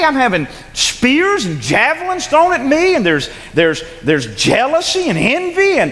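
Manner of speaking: rising to a high, pleading cry; David in despair. Acted. Preacher voicing David to the congregation, building desperately. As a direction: voice a hunted David, building to a wail.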